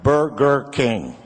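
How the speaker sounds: Burger King slow and mushy, sounding like Louisville; slow, slurred Burger King with a laugh; Southern drawl. Retold or imitated. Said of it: "he looked at me and went".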